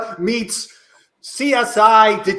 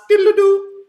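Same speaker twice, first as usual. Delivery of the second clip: sung diddle-doo; 8 semitones higher than usual. Acted. Lute imitation.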